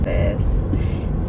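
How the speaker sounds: usual speaking voice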